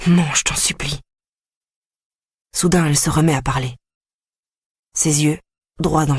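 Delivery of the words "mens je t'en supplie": whispered plea; desperate whisper. A narrator's reading. Boy whispers to his sister, begging her to lie. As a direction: beg her under your breath.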